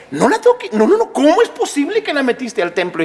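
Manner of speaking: high-pitched, spluttering mock outrage; acted indignation, starting high and easing down; indignant objection. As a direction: protest like a scandalized official. Sanhedrin man objecting to the healing.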